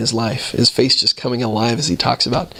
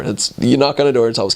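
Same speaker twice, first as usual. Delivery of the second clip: normal talking voice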